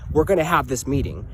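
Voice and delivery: imitated threatening official; threatening insistence. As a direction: lay down the law, menacing. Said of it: "She got all threatening".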